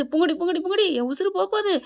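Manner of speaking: high, urgent begging for life; panicked plea. Retold or imitated. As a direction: act out a frightened call for help. Acted plea for her life.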